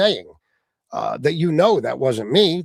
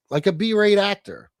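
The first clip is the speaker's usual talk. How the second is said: ordinary talk, nothing marked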